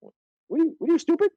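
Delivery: high-pitched, exasperated rising question; incredulous mock scolding. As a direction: scold them in sheer disbelief. Mock scolding of impatient men his age.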